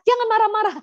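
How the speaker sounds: very high, mock scolding parent; memarahi anak. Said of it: "kita jadi marahin dia". (orang tua mengomel, nada melengking tinggi)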